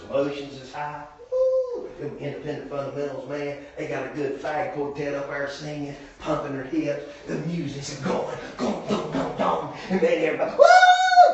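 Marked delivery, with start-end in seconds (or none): 1.3-1.9 high, quick whoop of mock worship excitement; church whoop. Hyped church service, crowd whooping. 10.6-11.3 a very high, loud whoop; loud church whoop. Retold or imitated. Everybody getting emotional at service.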